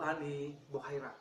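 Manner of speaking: ordinary talk, nothing marked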